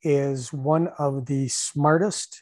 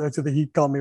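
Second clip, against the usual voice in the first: ordinary talk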